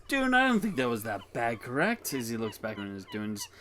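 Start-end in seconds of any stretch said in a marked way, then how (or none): 0.1-2.1 loud, raised character voice; seeking agreement. Acted. Character asks Dune to back him up, loud high appeal. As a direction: appeal loudly, rising high on the question.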